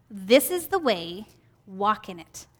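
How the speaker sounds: soft, slow and tender; gentle voice. Shown as a demonstration. Teacher voicing Jesus guiding her path, gentle and soft. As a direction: say it gently, like a guiding whisper behind you. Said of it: "I said that in kind of a gentle voice".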